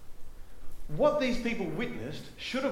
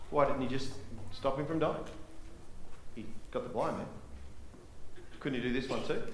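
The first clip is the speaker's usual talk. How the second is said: low-key, quieter doubting questions; sceptical grumbling; 3 semitones lower, 8 dB quieter, faster than usual. Acted. Acts out sceptical onlookers.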